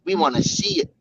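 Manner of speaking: high mocking whine; mocking youtuber. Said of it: "Pedro, you better show us".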